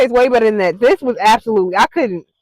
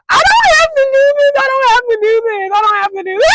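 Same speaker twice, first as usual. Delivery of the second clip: high, loud, fast tearful pleading; frantic repeated wail; panicked pleading; 11 semitones higher, 4 dB louder, faster than usual. Real, not acted. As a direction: beg yourself out of it, near tears. Panicking before eating the bug.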